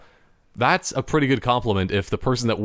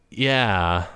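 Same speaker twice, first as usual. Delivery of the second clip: ordinary talk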